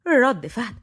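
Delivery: plain reading voice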